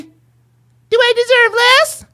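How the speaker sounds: high, slow mocking falsetto; drawn-out mocking falsetto. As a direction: squeak it out to mock her. Mocking the caller's voice.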